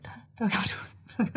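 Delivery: voiceless, very soft mock panic; whispered retold dread. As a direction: whisper it like a secret plea. Retold dread of being approached.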